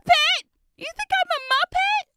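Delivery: high, loud indignant squeak; hammed-up offended squeal; mock outrage. Real, not acted. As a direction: play up the shock of being called a Muppet. Reacts to Muppet comment.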